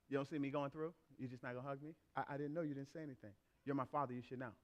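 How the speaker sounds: low, very soft mock sulking; daughter's bad day. Daughter expecting a hug from her father, soft mock sulk. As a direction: imitate a hurt child complaining under the voice. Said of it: "if she's having a bad day".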